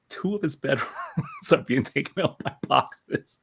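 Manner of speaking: high-pitched, laughing through the quote; laughing retelling. Real, not acted. Collector retelling his friend's absurd storage excuse, laughing.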